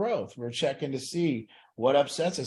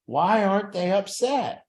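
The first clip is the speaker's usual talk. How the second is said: puzzled wondering; 6 semitones higher, 4 dB louder, slower than usual. Retold or imitated. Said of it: "And I always thought".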